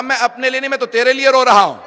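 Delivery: loud, high impassioned shout; Bahlul's answer shouted from the pulpit; loud preaching cry. Acted. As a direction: cry it out at full preaching force. Bahlul answers the caliph, preacher climax.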